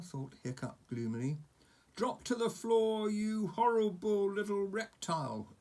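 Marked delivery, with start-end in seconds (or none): 2.0-5.6 high, loud roar of a chief; yelled command. Acted. Stoik yelling at Toothless, loud and high. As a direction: command the dragon down. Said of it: "yelled Stoik".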